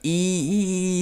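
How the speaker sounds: e as a long ee like in ravine; vowel demo. Shown as a demonstration. Teacher demonstrating the hirik vowel to learners, a drawn ee. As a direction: hold the bright ee, e. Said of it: "it makes a E".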